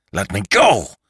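strained cry swelling on the last word; angry struggle. Acted. Caught killer thrashes to get free, angry cry. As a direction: burst out against their grip.

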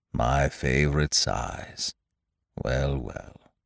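slow, low, softly resigned; sad, to himself. A narrator's reading. Eeyore mourning his balloon to himself, sad. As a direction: let it sink quietly into regret. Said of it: "he said to himself sadly".

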